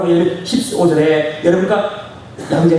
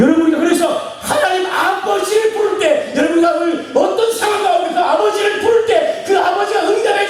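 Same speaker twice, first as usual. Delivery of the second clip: full-force preaching; 10 semitones higher than usual. Acted. Preacher blesses the congregation at full force. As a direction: preach it out to the whole room.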